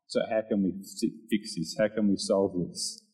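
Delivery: composed and low, acting out patience; calm, stepped-back response. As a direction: ask it quietly, taking a step back. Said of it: "just taking a step back".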